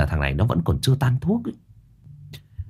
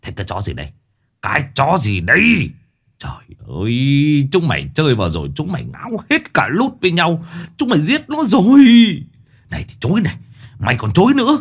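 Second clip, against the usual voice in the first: furious shouting; 6 semitones higher than usual. Acted. Old man beating the boy.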